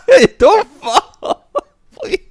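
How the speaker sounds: laughing. Real, not acted. Laughing while friend crawls.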